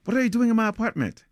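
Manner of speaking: high, loud challenge trying to sound scary; would-be scary high yell; acted scream. A narrator's reading. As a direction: scream it shrilly at a closed door. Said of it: "I scream at the bedroom door at the top of my lungs".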